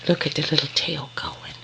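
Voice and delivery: soft doting whisper. Real, not acted. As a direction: breathe it softly at the wagging tail. Doting on puppy tail.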